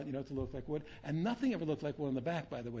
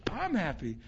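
joyful outburst; 6 semitones higher, 5 dB louder, slower than usual. Retold or imitated. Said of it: "I said".

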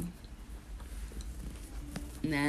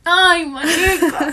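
laughing; 9 semitones higher, 7 dB louder, much slower than usual. Real, not acted. Reacting with laughter.

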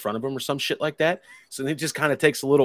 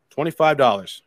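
"Twenty-five dollars" hit louder and higher; "Twenty-five dollars" stressed hard, higher then dropping; emphasis on the price; 4 semitones higher, 7 dB louder than usual. Real, not acted. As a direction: punch "Twenty-five dollars" as the key point. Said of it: "That is the emphasis on the price".

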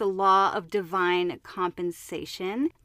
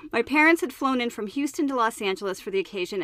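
ordinary delivery

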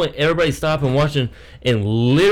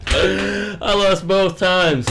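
slow mock defeat; 5 semitones higher, much slower than usual.

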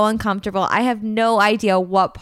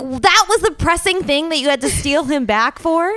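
higher, mock-incredulous challenge; incredulous retort; 6 semitones higher, 4 dB louder than usual. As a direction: throw the question back in disbelief. Mock disbelief at retold excuse.

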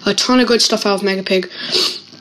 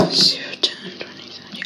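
whispered counting; 10 dB quieter than usual. Real, not acted. Counting under his breath.

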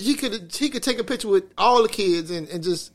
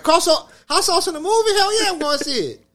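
eager basketball fan, stretched out and pitched up; hyped fan; 11 semitones higher, 5 dB louder than usual. Retold or imitated. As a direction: sell it like a kid who can't wait.

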